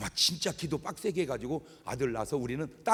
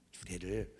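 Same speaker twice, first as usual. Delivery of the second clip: usual speaking voice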